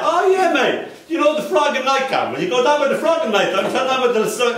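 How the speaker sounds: put-on high, chummy London accent; Londoner. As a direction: give directions like a London local. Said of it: "everybody said".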